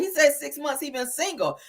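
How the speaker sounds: usual speaking voice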